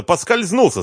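angry outburst, pitched high; woedend uitgeroepen. A narrator's reading. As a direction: cry it out, losing your temper. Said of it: "закричал он, выходя из себя".

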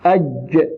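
أج stopped hard on the jeem; sound held shut. Shown as a demonstration. Teacher demonstrating shiddah on jeem, sound blocked. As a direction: trap the voice on the ج of أج. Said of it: "حبس الصوت".

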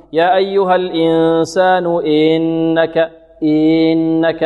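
"إنك" dragged into a long "eee-nnaka"; slow chant, overlengthen the i of "إنك"; stretched kasra. Shown as a demonstration. Teacher showing what not to say, stretched vowel. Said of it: "ولا نقول كذلك بمط الكسرة".

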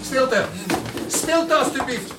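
loud, firm, slow; commanding call for silence. Acted. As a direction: silence the crowd from the front. Director calls hall to silence. (directeur roept luid om stilte)

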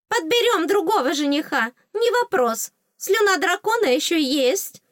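high, chirpy fairy voice; placating fairy. Acted. Fairy promises another suitor, high and placating. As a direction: pipe up to smooth things over.

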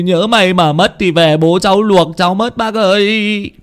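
high-pitched wailing plea for help; tearful, stuttering begging; panicked stammering. A narrator's reading. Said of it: "mà lắp bắp".

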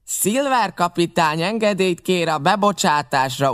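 the other pirate answering the captain; pirate messenger. A narrator's reading. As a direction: formally announce the request. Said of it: "a másik ember válaszolt".

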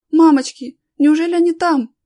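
high, loud, anxious cry; acted woman's alarm; aghast gasp. A narrator's reading. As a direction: gasp in dread for the children. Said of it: "ахнула Галя".

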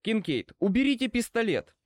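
high, urgent command. A narrator's reading. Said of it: "скомандовал я". (командным тоном)

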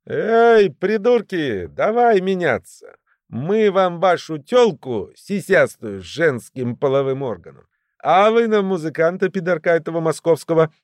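confident, high-pitched taunting call; Весёлый, mocking. A narrator's reading. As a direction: jeer loudly from out in the street. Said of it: "издевательский, уверенный, громкий голос Весёлого".

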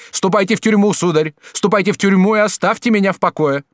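put-on indignant girl's rebuff; indignant dismissal. A narrator's reading. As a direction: go to prison and leave me be. Gervaise sends him away.

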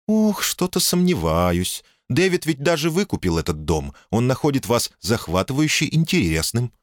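calm, sensible woman speaking up high; woman's voice. Acted.

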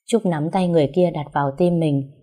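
ordinary talk

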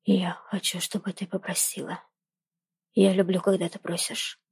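low hushed male voice; urgent whisper. Acted. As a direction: keep it close and hushed. Jordan continues whispering.

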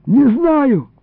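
high, pleading denial; frightened plea. A narrator's reading. Boy questioned by his captor, frightened plea. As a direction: whine it high in fear. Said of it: "взмолился я дрожащим голосом".

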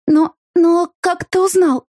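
high, slow, shaky stammer; stammering bleat. A narrator's reading. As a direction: stutter it out in shock. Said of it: "проблеяла я, заикаясь".

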